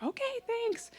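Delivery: very high, singsong politeness; chirpy thanks. Retold or imitated. Customer thanking the second-window worker, chirpy high thanks.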